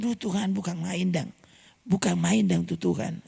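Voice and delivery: soft, hushed and overwhelmed; awed hush. Retold or imitated. Preacher voicing a man overwhelmed by God, hushed. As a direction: breathe it out in wonder to God. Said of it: "Saya bilang".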